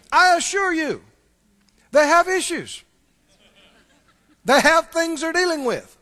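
full-force preacher declaring; preaching. Real, not acted. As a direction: preach it hard to the congregation.

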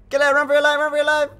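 high, loud mock panic; run-for-your-life hammed-up alarm. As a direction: yell it like a fleeing rat in a mock fright. Panicked yelling at the humans.

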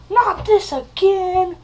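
high, loud, drawn-out wail; overplayed dread; mock dismay. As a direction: cry out in hammed-up horror. Mock horror at recurring effects.

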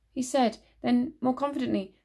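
ordinary delivery, nothing marked

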